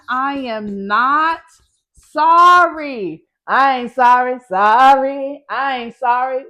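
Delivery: loud, drawn-out, defiant "sorry". Acted. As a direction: belt it out unapologetically like a pop diva. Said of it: "channel in your [name]".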